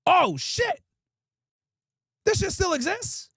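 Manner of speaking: high, amazed; real surprise. Real, not acted. Streamer discovering the old hobby shop still exists, truly surprised. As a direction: blurt it out in disbelief.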